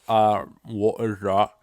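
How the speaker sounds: slow, muffled mock whale voice; puzzled whale. Whale with a man in its mouth, slow and puzzled. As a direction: draw it out, mouth full and baffled. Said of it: "It had him in his mouth ... and was like".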